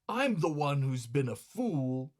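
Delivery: ordinary delivery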